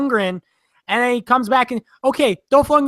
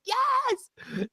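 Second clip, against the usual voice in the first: excited yes; 8 dB quieter than usual. Retold or imitated. Excited reaction to cameo.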